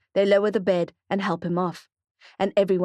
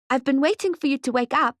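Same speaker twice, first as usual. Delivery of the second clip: put-on higher child's voice, eager; little boy; 6 semitones higher than usual. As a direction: speak up high like a small boy. Young boy Mally speaks.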